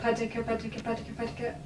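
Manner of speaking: rapid patika repeats; plosive drill. Shown as a demonstration. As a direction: fire off patika fast. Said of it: "just as fast as you can".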